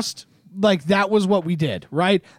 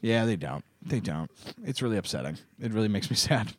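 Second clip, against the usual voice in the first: much lower and softer, deflated; mock sadness; 11 semitones lower, 11 dB quieter than usual. Host pretending to be hurt by WWE, low and soft. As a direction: sulk it out quietly.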